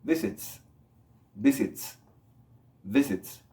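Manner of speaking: "visits" ending in a crisp TS; careful "visits" with a hissed ending. Shown as a demonstration. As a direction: add a voiceless S after the T of "visits". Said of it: "más el sonido de S".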